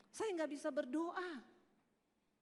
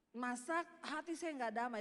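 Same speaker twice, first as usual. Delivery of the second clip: usual speaking voice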